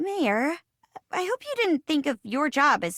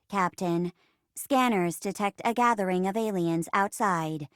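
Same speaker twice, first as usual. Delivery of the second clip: even, measured robot computer voice; robot assistant. Acted. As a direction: report like a ship's computer, evenly paced.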